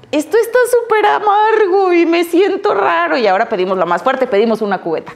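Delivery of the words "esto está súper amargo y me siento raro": voz más aguda, de chava que prueba por primera vez; primera cerveza asqueada. Retold or imitated. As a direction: como quien hace muecas al sabor amargo. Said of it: "La primer cerveza fue de". (joven que prueba cerveza, disgustada y desconcertada)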